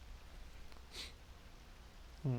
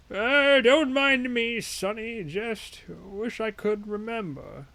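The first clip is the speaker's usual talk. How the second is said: put-on high, slow, frail old man voice; a forgetful old man mumbling slowly; 6 semitones higher, slower than usual. Old man talking to passerby.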